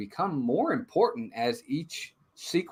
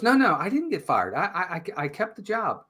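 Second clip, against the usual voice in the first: higher-pitched, stammering quoted denial; hurried denial; 6 semitones higher than usual. Retold or imitated. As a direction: imitate a dad covering up, tripping over words. Dad denies firing to son.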